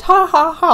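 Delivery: high, loud "ha ha ha"; imitated laughter. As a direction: mimic mom's hearty laugh. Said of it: "She laughed".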